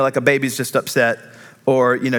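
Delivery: normal talking voice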